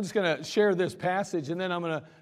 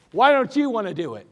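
loud, higher demanding question; mad sergeant; 6 semitones higher, 8 dB louder than usual. Retold or imitated. Angry sergeant demanding why a soldier won't volunteer. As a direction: jab the question at him. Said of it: "He's getting mad".

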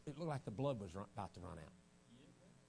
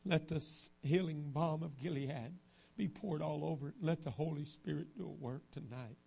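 prayer. Real, not acted. Preacher praying over the congregation, earnest.

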